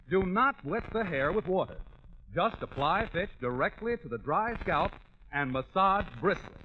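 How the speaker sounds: normal talking voice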